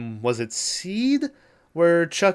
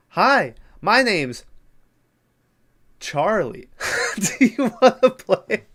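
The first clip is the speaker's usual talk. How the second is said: high, slow sing-song doll voice ending in a laugh; creepy doll greeting; 6 semitones higher, slower than usual. Retold or imitated. Killer doll introduces himself to the little girl, high and sing-song. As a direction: play the sweet-sinister killer doll.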